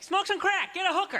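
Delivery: high, gleeful little devil on the shoulder; tempting devil voice. Acted. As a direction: egg him on like a devil whispering ideas. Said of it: "there's, like, a devil and a devil".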